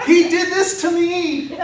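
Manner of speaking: hammed-up imitation of her wail; father mimicking his crying daughter, laughing; mock tearful daughter. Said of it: "she's calling me and in tears".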